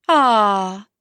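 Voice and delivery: loud drawn-out audience aww; sympathetic aah. A narrator's reading. Audience sighing at the lonely spinster, loud aah. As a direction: give a big sympathetic aah. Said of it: "The audience goes".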